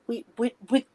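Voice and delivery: ordinary talk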